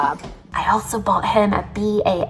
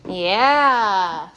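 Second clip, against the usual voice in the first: ordinary talk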